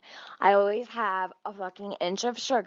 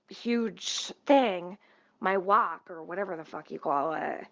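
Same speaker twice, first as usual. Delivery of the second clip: ordinary talk, nothing marked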